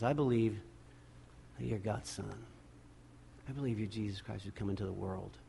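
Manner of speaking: very soft, low, reverent reply; hushed confession. Acted. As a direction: confess it quietly, almost to yourself. Voicing Martha's quiet confession of faith.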